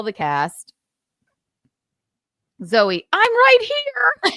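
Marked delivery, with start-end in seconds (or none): 3.1-4.3 slow, high, loud mock cry of I'm right here; hammed-up shout. Daughter mimics Zoe bursting onto stage, hammed-up shout. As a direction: belt it out like a stunned star grabbing the mic.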